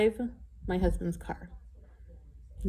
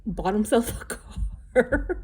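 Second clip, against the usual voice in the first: laughing through the words, slow; 4 semitones higher, slower than usual. Real, not acted. Proud wife telling viewers about husband's car, laughing. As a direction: giggle while drawing it out. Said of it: "And I'm laughing".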